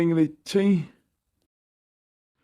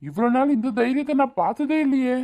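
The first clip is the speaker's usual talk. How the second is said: high-pitched surprise; amazed; 7 semitones higher than usual. Acted. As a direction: marvel at her boldness. Amazed at Vadivu's courage.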